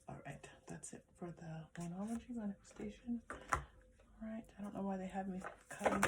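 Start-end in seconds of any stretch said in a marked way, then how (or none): 0.1-4.4 whispered aside to herself. Real, not acted. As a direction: breathe it out, barely voiced. Wrapping up section, aside.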